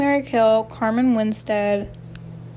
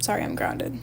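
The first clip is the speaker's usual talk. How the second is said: whispered quote; 7 dB quieter than usual. Retold or imitated. Retelling a Tinder chat.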